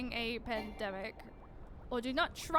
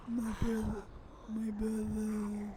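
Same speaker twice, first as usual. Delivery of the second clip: zombie; 3 semitones lower, 3 dB quieter than usual. Pretend. More zombie voice attempts.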